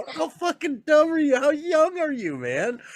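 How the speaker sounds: high, ribbing disbelief; mock exasperation. Ribbing a young worker who missed the joke, high and mocking. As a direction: tease him for missing the joke.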